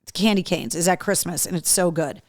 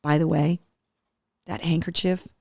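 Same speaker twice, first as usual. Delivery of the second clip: ordinary talk, nothing marked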